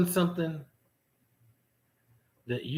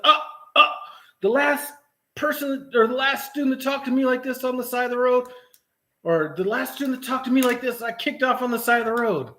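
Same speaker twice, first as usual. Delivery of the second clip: higher, stammering mock of the trainer; flustered trainer mimicry; 8 semitones higher than usual. Imitating the flustered trainer lost for words, higher and mocking. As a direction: ape him as lost for words. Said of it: "He's like, "Oh, oh".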